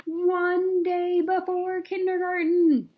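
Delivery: slow, high, loud chapter announcement; countdown heading. A narrator's reading. Reading a picture book to kids, announcing the day heading. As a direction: announce the day like a big title.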